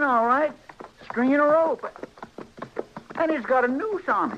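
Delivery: rising alarm, pitched well up; shocked discovery of a hanging rope; startled alarm. Acted. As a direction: voice jumps high in alarm as he spots the noose. Chester spots a man stringing a noose.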